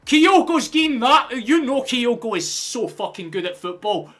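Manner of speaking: real full-force celebration, very high and very loud; wild roar easing into loud, high raving; ecstatic goal scream. Real, not acted. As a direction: scream the goal, drawing out the cries.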